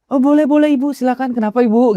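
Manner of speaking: very high, obliging host voice; ramah dan sopan. Retold or imitated. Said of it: "ramah, sopan gitu ya". (sopan dan ramah dengan nada tinggi)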